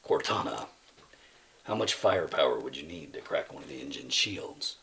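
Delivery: low, hushed soldier addressing an AI; very soft, deep-pitched in-character line; game hero role-play. Acted. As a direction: murmur it low, like a hero on comms. Quoting Halo to Cortana.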